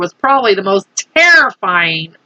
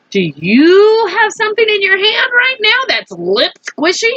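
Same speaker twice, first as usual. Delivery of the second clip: playful question; 11 semitones higher than usual. Real, not acted. Teacher asking kids playfully.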